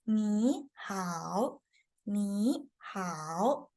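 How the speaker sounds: slow "ni hao", each syllable's tone drawn out; teacher stretching "ni hao" into dipping and rising tones; Mandarin tones. Shown as a demonstration. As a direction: model "ni hao" very slowly, syllable by syllable. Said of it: "that is the pronunciation".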